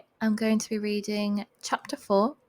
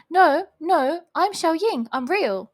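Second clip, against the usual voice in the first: higher, louder flustered protest; 7 semitones higher, 6 dB louder, slower than usual. A narrator's reading. Accused girl insists who she is, flustered. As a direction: protest your innocence in a fluster. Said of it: "she waved in a fluster".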